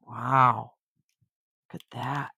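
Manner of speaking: ordinary delivery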